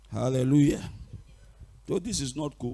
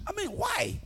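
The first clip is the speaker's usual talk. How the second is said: high-pitched, softer dismissal; scoffing; 5 dB quieter than usual. Real, not acted. Preacher belittling witches to congregation, high-pitched scoffing. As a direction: toss it off in a high voice.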